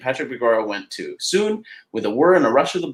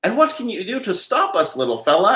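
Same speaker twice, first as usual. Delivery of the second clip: cocky taunt; 8 semitones higher than usual. Acted. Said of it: "asked Donald Duck with a chuckle".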